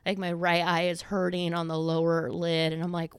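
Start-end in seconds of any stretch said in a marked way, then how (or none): none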